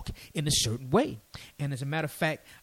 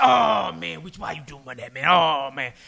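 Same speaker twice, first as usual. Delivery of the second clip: imitated dismay; 4 dB louder than usual. Young brother's dismay, acted.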